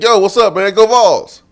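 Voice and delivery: high, loud Go Vols shout; loud fan greeting. Retold or imitated. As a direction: hail him like a fellow fan. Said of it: "I said".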